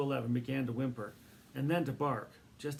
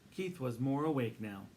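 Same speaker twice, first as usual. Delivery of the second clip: plain reading voice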